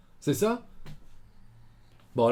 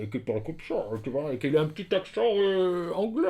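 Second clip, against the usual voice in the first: muffled masked villain, Darth Vader style; demonstrating a garbled villain voice; Bane; 2 semitones higher than usual. Shown as a demonstration. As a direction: talk through a mask. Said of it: "tout un peu à la Dark Vador".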